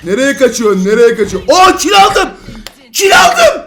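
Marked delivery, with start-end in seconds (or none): 1.5-2.3 higher, loud triumphant cry; excited shout. Real, not acted. Got a kill. 2.9-3.6 very high, loud squeal of victory; shrieking with joy. Real, not acted. Got a kill, repeats.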